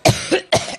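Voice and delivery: dry hacking cough; coughing fit. Real, not acted. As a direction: cough then apologize. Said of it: "excuse me".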